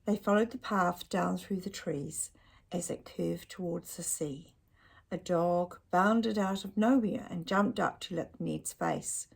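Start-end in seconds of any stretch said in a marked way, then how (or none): none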